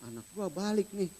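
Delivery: a stingy father ducking his son; half-comic alarmed dad; worldly father. Retold or imitated. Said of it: "mungkin akan berpikir".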